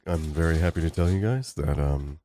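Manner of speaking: soft, low, sweet-guy impression; Henry Cavill. Imitating the sweet actor thanking his fans, soft and low. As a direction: gently put on a polite, sweet leading man. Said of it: "just the way he talks, he's so sweet".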